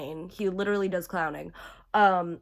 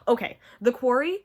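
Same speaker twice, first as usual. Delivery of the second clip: ordinary talk, nothing marked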